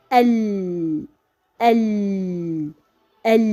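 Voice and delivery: drawn-out l in Al, tongue held on it; articulating lam. Shown as a demonstration. Teacher showing where lam is articulated, drawn-out l. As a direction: say "al" and hold the l long. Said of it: "forms the point of articulation of the letter".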